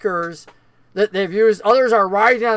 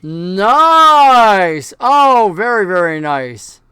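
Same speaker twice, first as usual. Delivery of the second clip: long, loud, drawn-out cheer; thrilled cheer; 7 dB louder, much slower than usual. Real, not acted. Game master hails player's natural twenty, thrilled and loud.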